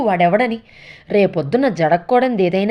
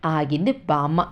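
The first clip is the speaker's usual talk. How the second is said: ordinary delivery, nothing marked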